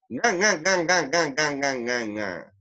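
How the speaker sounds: honking cartoon laugh through the nose, stepping down; Calamardo laugh. Shown as a demonstration. Teacher imitating Bob Esponja's neighbour, nasal laugh. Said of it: "imitar un poco la son-, la risa de Calamardo".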